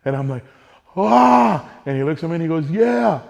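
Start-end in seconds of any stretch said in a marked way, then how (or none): 0.9-1.7 a high, loud shout of pure joy; elated shout. Retold or imitated. First deer drops, adrenaline release. 2.7-3.2 high, loud, falling yeah; triumphant yeah. Retold or imitated. Chris celebrates the kill.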